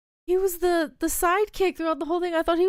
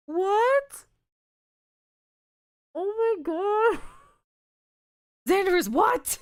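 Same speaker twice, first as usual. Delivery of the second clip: high, rising cry of disbelief; shocked disbelief; 7 semitones higher, slower than usual. Real, not acted. Player learns Xander is dead, shocked disbelief. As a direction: pitch shooting up in real shock. Shocked at favorite character's death.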